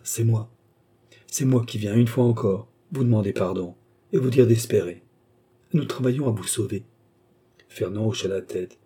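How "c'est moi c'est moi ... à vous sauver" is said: usual speaking voice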